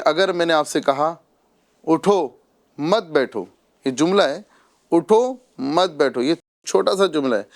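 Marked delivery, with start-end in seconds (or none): 1.9-3.4 "اٹھو", a pause, then "مت بیٹھو" together; pause after اٹھو. Shown as a demonstration. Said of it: "یہاں پر رک رہا ہوں". 4.9-6.2 اٹھو on its own, then مت بیٹھو as one group; pause after اٹھو. Shown as a demonstration. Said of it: "یہاں پر رک رہا ہوں".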